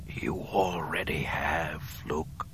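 slow, breathy dying whisper. Acted. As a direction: whisper it softly and slowly. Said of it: "he whispered".

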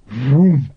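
voiced burst of igniting fog; imitated whoomp. A narrator's reading. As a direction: puff out a sudden whooshing whoomp. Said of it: "made a noise like".

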